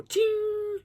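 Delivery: high ringing metallic ching; sudden high chain ring; imitated chain ching. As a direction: mimic a chain snapping taut. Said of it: "the chains on the tent".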